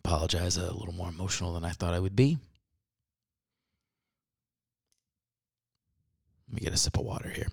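soft, low, pretending to hold back tears; subdued demo of regaining composure; composed apology. Shown as a demonstration. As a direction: apologize quietly as if collecting yourself. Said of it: "maybe you state what's happening".

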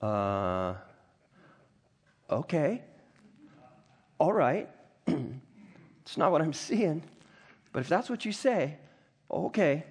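hesitant acted reply with long pauses; doubtful, reluctant agreement. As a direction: go along with it, unconvinced. Acts servant's doubtful reply, paraphrased.

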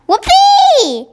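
high, loud, gleeful whoop; long squealed cheer; joyful shout. A narrator's reading. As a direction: shout it out with delight. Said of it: "shouted the B F G".

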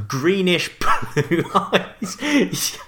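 breaking up laughing while reading. Real, not acted. Co-host cracking up reading trademarks, laughing.

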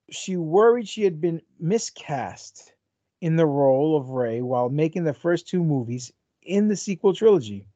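ordinary talk, nothing marked

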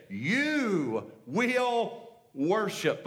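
very slow, each word drawn out; slow hushed proclamation. Acted. Preacher voicing Jesus speaking to the woman, slow and solemn. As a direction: lay the words down one by one.

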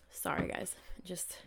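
quick real whisper, apologising mid-sip. Real, not acted. Pausing to sip tea, whispers sorry to viewers. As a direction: breathe it out unvoiced, an aside. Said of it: "sipping on my tea".

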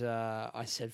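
ordinary talk, nothing marked

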